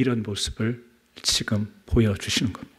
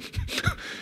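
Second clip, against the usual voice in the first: short laugh; 11 dB quieter than usual. Real, not acted. Laugh after mock cursing.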